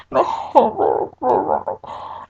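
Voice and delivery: dry sob. Retold or imitated. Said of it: "I'm crying without crying".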